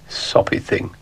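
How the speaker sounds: whispered under the breath; muttered aside. Acted. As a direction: scoff it half to yourself. Muttered aside about the poem.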